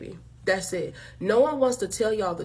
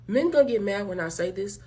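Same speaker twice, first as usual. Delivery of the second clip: usual speaking voice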